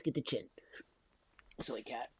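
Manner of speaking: ordinary talk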